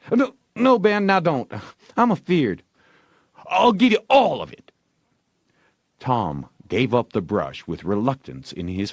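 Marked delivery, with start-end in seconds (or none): 0.1-2.6 high, louder protest; hammed-up fretting kid; mock afraid Ben. A narrator's reading. Ben retracting fearfully. 3.5-4.7 high, louder, eager; excited all-in offer; desperate Ben. A narrator's reading.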